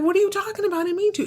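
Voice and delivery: high-pitched imitation of a defensive kid; high, indignant mock kid voice; protesting kid. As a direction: mimic a child protesting innocence. Kid protests being sent off.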